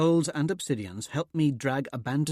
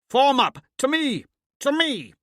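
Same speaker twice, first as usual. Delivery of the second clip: high, loud commanding bellow; 9 dB louder than usual. A narrator's reading. Commander rallying his soldiers, bellowing. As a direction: bark the order to the troops. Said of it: "I bellow".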